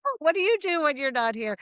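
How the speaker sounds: bubbly small talk to strangers at a sex party; chatty socialite. Retold or imitated. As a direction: play the eager party mingler.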